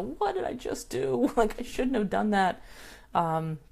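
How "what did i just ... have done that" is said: mock dismay. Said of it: "you can fire it off and go".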